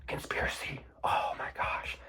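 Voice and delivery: whispered dramatic gasp of conspiracy; hushed mock-shocked whisper. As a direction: whisper it like a big secret.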